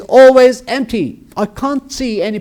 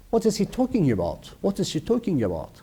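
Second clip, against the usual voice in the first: much softer, quick aside; hushed disbelief; 12 dB quieter, faster than usual. Acted. Teacher wondering at an impossible claim, in a hushed aside. Mock gossip about the grandfather's claim.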